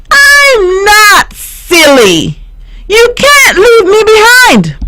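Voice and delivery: very high, loud, whiny tiny shout; teeny whiny voice. A narrator's reading. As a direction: squeak it out like a tiny dot. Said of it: "teeny, weeny, whiny voice cried out".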